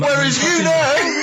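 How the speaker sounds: high, slow laughing jibe; laughing at a friend. Real, not acted. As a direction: cackle through the words. Laughing mock.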